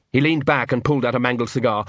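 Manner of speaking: usual speaking voice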